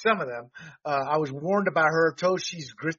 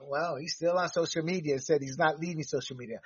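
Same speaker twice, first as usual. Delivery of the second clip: ordinary talk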